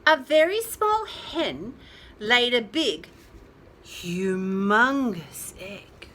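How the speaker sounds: usual speaking voice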